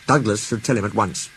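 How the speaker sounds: ordinary delivery, nothing marked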